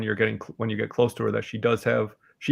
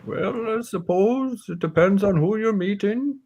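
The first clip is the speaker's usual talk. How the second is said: put-on higher-pitched old warrior voice; musing aging warrior, pitched up; old warrior woman; 10 semitones higher than usual. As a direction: answer as the old warrior, voice lifted. Game master answers as Hilin.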